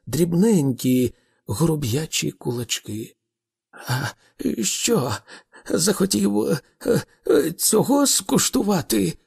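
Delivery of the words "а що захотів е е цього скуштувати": high, halting, timid challenge; trembling. A narrator's reading. Arthur raises his little fists at Thor, trembling. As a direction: stammer out a nervous threat. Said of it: "повторив Артур тремтливим".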